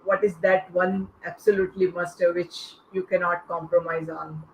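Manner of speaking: usual speaking voice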